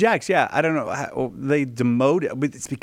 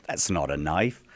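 put-on Australian accent; 8 semitones lower, 4 dB quieter than usual. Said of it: "how was my Australian accent".

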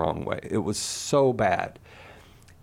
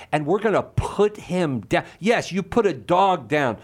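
ordinary talk, nothing marked

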